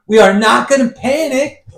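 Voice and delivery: high, loud hammed-up movie speech; Samuel L. Jackson impression. Host imitates Samuel L. Jackson's shark-movie speech, loud. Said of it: "Sam L's in that".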